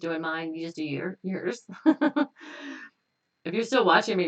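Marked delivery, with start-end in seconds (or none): 1.7-2.9 short high laugh breaking out; burst of laughter. Real, not acted. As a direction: laugh at yourself, higher and louder. Laughs at own aside.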